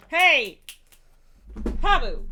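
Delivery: loud warning to a pet; sharp scolding. Real, not acted. Telling Pabu off for bothering his sister, loud scolding. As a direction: call the cat off loudly.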